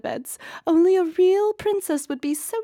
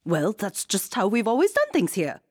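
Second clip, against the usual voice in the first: blustering; 5 semitones higher, 3 dB louder than usual. Acted. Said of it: "blustered the queen".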